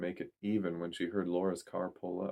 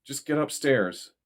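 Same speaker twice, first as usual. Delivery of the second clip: urgent; 6 semitones higher, 5 dB louder than usual. A narrator's reading. Urging her up the stairs.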